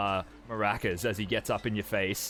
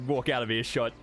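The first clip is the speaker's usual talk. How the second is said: ordinary talk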